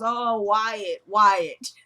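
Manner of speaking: hammed-up fake moan of a name; mock moaning. Wife faking noises for the listening mother, mock moaning. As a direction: pretend to moan for someone listening in. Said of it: "moaning Jeffrey's name, but nothing's really happening".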